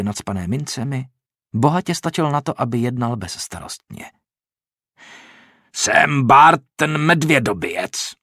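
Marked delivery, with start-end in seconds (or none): 5.7-8.1 loud, slow bragging bellow; loud, slow bandit boast; boastful roar. A narrator's reading. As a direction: announce yourself to scare. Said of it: "zařval".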